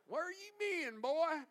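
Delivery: a Southern country preacher asking; southern accent. Acted. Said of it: "I like to put a little southern accent in there".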